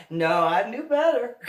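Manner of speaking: high, loud, through laughter; amused and loud; laughing. Real, not acted. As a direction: crack up while dismissing the idea. Laughing after acted shout.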